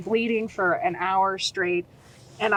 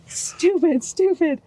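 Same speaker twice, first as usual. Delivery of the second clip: self-scolding; 5 semitones higher, 7 dB louder, slower than usual. Retold or imitated. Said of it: "I was like".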